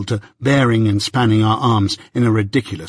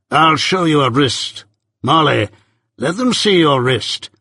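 slow, deliberate order to a servant; Jaggers, commanding; 3 semitones higher than usual. A narrator's reading. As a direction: present her slowly, with firm authority.

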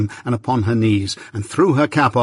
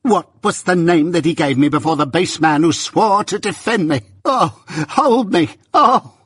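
blindly furious; 7 semitones higher than usual. Acted. Sister demanding to be held, hysterical fury. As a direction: build theatrically to a high wail. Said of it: "became blindly furious by regular stages".

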